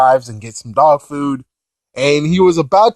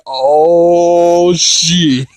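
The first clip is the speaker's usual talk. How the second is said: long drawn-out "oh" of alarm; mock dread; 4 dB louder than usual. Onlooker hearing Boo's outburst, mock dread. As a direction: stretch it out, louder, as trouble dawns.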